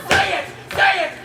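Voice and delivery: building from eager to loud and high; rising, pleading insistence; insistent demand. Acted. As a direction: press her harder each time until you're calling out. Lover begging for a confession.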